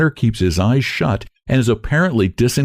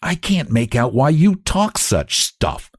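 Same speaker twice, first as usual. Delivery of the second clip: angry; 7 semitones higher, slower than usual. A narrator's reading. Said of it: "says the other tall one angrily".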